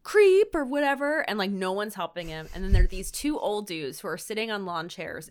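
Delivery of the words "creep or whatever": high, loud jeer; mock heckle. Passing driver at the man in the street, mock heckle. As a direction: call it out like an annoyed driver.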